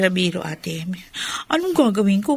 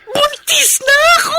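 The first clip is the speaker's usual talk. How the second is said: much higher, louder shout through happy tears; joyful crying shout; 5 dB louder than usual. Acted. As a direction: burst out with the news, crying with joy. Wife announces pregnancy to husband. (masayang sigaw habang umiiyak)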